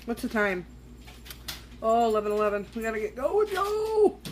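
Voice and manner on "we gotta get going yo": starts normal, swoops way up high; playful rallying call. Pretend. Vlogger sees it's eleven eleven, playful rising call. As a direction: climb to a high, stretched "yo". Playful hurry after checking the time.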